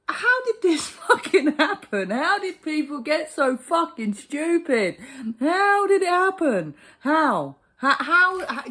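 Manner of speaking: exasperated cry. Real, not acted. Ranting at how stupid people got, slow exasperated cry. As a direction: throw the question at the sky in disbelief.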